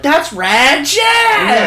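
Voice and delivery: high, stretched-out excited squeal; mock fan cry, long final word; imitating starstruck kids. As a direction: shriek it like an amazed fan. Kids' excited recognition, imitated.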